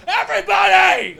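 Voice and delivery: high, strained mock gangster scream; Tony from Scarface. Gangster asked who he's talking to, mock scream. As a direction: stretch it into a hammed-up movie scream. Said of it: "fucking guy from Scarface".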